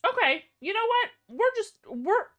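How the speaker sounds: high, strained backing off; nervous. Real, not acted. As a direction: squeak out of fear. Scared by alert sound.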